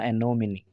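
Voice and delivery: "एनोमनी" ending in a clear ee; sounded final e. Shown as a demonstration. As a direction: do not swallow the ni in "एनोमनी". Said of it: "silent नहीं होगा बल्कि इसको और भी ज़्यादा stress". ("एनोमनी" के अंत में ई साफ़ बोलें)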